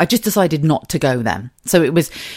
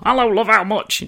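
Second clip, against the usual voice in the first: cheeky punter; 6 semitones higher than usual. Pretend. Punter at the door joke.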